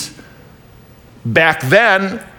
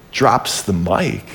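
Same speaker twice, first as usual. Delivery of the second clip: ordinary talk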